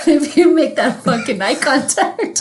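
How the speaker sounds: talking through real laughter; laughing. Real, not acted. Explaining the eye contact signal to co-host, laughing. Cracking up over the demonstration.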